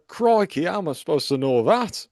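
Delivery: put-on a rough try at a Mackem accent, crikey pitched high; half-hearted mock Mackem accent. Host gives the sign off in a Mackem accent. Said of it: "Mackem accent".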